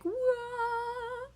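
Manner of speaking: a dreamy exclaimed whoa; awed whoa. Real, not acted. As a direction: float on the high. Still high from last night.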